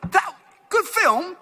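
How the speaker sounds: high-pitched imitation of blunt Australian moviegoers; Australian critics. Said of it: "whenever anyone comes to us from Australia".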